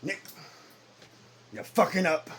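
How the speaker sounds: firm father rebuke; stern God. Acted. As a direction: look him in the eye and call it. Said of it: "look you hard in your eyes".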